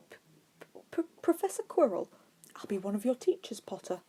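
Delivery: a jittery teacher tripping on his name; stuttering Quirrell. A narrator's reading. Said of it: "Despite the stuttering and faint tremble".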